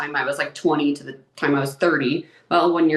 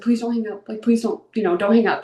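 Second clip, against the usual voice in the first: higher-pitched, imploring quote; mild retold pleading; 6 semitones higher than usual. Begging dad to stay on the phone, mildly pleading. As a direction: plead lightly, voice lifted, as if retelling it. Retelling her plea to her dad.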